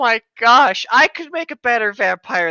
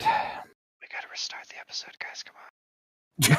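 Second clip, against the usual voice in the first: slow, drawn-out whispered plea; whispered nudge to the group; 4 semitones lower, 11 dB quieter, slower than usual. Real, not acted. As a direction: whisper it like a joking complaint.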